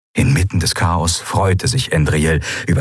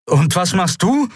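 higher call over the noise; called question; 10 semitones higher than usual. A narrator's reading. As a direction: call it across the din. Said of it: "rief Kai über die Todeswehen".

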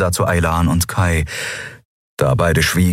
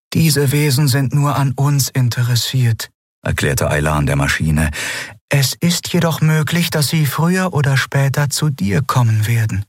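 higher, gentle explaining voice; Aylan's voice; 7 semitones higher than usual. Acted. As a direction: explain it carefully to the machine. (sanfte, erklärende Stimme)

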